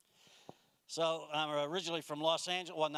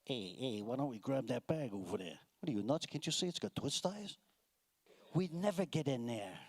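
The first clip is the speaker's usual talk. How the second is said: thieves at airport; 4 semitones lower than usual. Retold or imitated. Airport thieves scheming over a bag, lower tough-guy voice. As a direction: mutter it like a New York thug. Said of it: "bad guys at the airport going".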